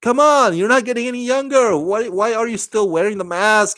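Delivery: high-pitched, exasperated scolding; mock pissed-off mother. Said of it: "and she's pissed about that".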